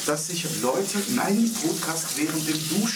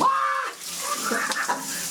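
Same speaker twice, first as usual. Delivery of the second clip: sudden high-pitched shriek; high yelp at cold water. Retold or imitated. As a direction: yelp as icy water hits. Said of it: "und dann machst du".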